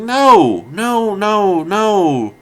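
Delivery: high, loud retold protest; emphatic refusal. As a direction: wail the denial, stretching each one. Retold refusal to agreeing friends.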